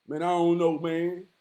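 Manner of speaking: low, soft, dejected mumble; low, defeated brother. Retold or imitated. Voicing a struggling brother telling him it ain't enough, dejected and low. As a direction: sound worn down and unappreciated. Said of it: "I've talked to some brothers who were slipping".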